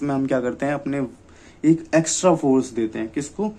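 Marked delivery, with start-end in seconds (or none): none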